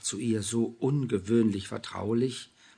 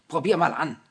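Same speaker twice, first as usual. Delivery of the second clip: higher, louder command; pushy; 6 semitones higher, 9 dB louder than usual. A narrator's reading. Julia ordering Anna to try it on, pushy and loud. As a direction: insist bluntly. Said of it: "widersprach ihre Freundin ruppig".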